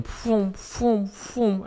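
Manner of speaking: high, breathy whoosh of passing cars; whoosh noises. Retold or imitated. Imitating police cars speeding past with high whoosh noises.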